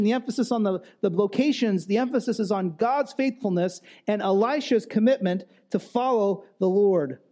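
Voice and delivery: normal talking voice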